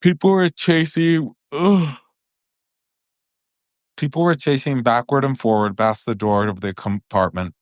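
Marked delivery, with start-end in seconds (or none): none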